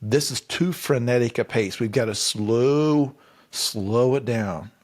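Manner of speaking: lightly mocking, deliberately slowed pitch. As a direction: stretch each word like you're savoring it. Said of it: "they're like, before they're saying".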